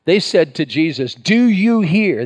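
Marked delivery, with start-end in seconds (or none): 1.1-2.2 slow, indignant, pitched higher; indignant demand. Retold or imitated. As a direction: demand it with offended disbelief. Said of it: "They were indignant".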